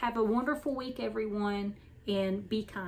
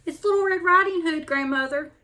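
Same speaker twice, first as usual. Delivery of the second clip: put-on high, louder child's voice; little girl calling; 7 semitones higher, 7 dB louder than usual. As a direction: call out brightly to grandmother. Girl calls at the door.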